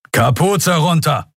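high, slow commanding shout; thundered command. Acted. Lieutenant ordering Kai to unhood, thundered command. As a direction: thunder it out as a barked military order. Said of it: "donnerte der Leutnant".

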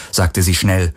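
ordinary delivery

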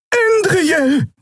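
very high cried-out name; alarmed call. A narrator's reading. Xea calls to Endriel in the fight, high cry. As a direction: call out sharply, far above normal pitch. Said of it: "rief Xea".

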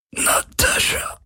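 slow, breathless whisper; dying whisper. Acted. Dying Parker whispering a name, slow and breathless. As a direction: gasp the name with the last breath. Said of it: "Blut kam über seine Lippen und ein Wort".

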